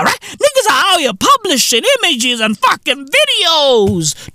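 ordinary delivery

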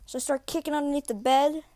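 ordinary delivery

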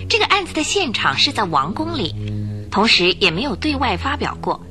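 ordinary delivery

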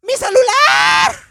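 mocking a telenovela girl screaming for her phone; hammed-up screaming girl. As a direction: wail for the phone like a hysterical teen. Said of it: "dice de que".

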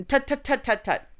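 tutting tongue clicks; scolding tut-tut clicks. Retold or imitated. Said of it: "And I go".

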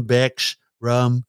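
ordinary delivery

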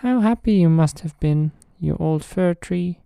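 high, squeaky little mouse voice; tiny eager mice asking. Acted. Mice speaking in story.

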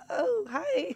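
tiny, very high-pitched hello; mock innocent greeting. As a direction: act innocent to a grumpy husband, squeaky high. Wife greets grumpy husband, retold.

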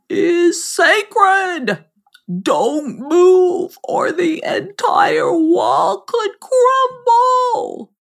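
high, slow, urgent frog voice; warning frog. Acted. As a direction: warn her gravely, drawn out. Frog warns about sacred brick.

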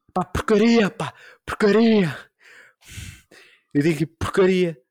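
high, loud exasperated pá, porcaria; mock angry curse. S0 showing how he swears at home: loud mock outburst. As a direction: fake a mild curse in a fit of anger.

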